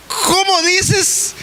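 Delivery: very high, slow, whining "¿Cómo dices?" with a crying edge; pleading high question. Retold or imitated. Preacher asking the Lord a second time, in a high, slow, crying plea. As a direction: beg him to say it again, stretched and high. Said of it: "Y le volví a decir".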